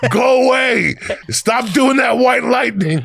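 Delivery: high, loud, laughing shout; mock parent yell. Parents shouting at their son through the door, high, loud and laughing.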